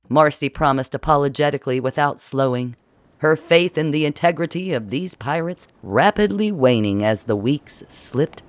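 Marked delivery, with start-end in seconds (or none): none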